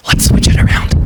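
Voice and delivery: whispered, hushed and scheming; conspiratorial whisper. Acted. Rabbi whispers to colleague in his office, scheming. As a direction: whisper it like a secret plot.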